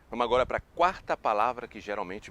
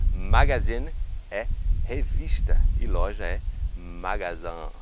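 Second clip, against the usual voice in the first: magazine and magazin said slowly and loudly; slow loud rule; 3 semitones lower, 11 dB louder, slower than usual. Shown as a demonstration. Teacher hammers the memory rule home, slow and loud. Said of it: "Então".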